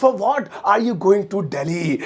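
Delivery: stretched out. Shown as a demonstration. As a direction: drill it for the class. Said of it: "तुम किस लिए दिल्ली जा रहे हो".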